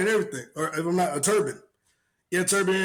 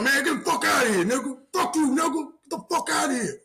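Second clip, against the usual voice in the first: pitched-up angry dismissal; high angry yell; 8 semitones higher than usual. Retold or imitated. Dude yelling from his house, high and angry. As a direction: yell him away from the house.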